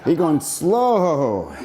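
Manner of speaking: long falling vowel on each slow; drawn-out slow. Shown as a demonstration. Coach holding the group back, drawn-out slow. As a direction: stretch the word out, gliding down. Coaching slowness during exercise.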